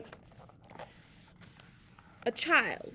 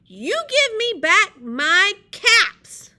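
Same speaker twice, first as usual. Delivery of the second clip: slow, high, loud demand; hammed-up storybook shout; scolding peddler; 7 semitones higher, 14 dB louder, much slower than usual. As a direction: punch each word like a cross peddler. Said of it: "shaking his finger at them".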